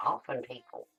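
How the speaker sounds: through a tube. Real, not acted. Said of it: "somebody's talking through a tube".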